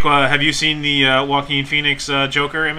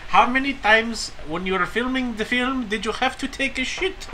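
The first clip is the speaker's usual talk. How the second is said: higher, softer put-on voice; silly fan voice; 8 semitones higher, 5 dB quieter than usual. Podcaster plays a fan asking a crude question in a goofy voice. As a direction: ask with mock innocence. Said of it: "it's a different voice".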